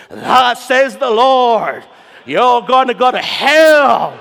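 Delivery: slow, loud, booming doom; mock hellfire preacher. Mocking a coworker who preaches hellfire at work, slow and loud. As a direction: thunder it like a street preacher.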